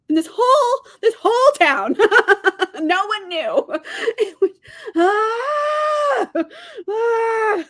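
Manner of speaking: high, loud laughing near-cry of frustration; laugh-cry out the outrage. Real, not acted. Vlogger laughing in frustration at the book's plot holes. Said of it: "I'm so upset with it".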